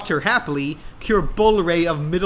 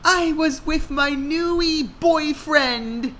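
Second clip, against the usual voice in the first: high, slow swooning voice; dreamy girl; 11 semitones higher, slower than usual. A narrator's reading. Hannah telling her dad about her new boyfriend. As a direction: say it in love.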